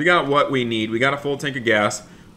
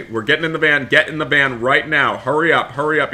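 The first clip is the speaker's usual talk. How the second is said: ordinary talk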